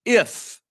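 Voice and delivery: "If" hit high and loud; emphatic if. Shown as a demonstration. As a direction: land the single word "If" hard. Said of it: "Who knew being saved had an if".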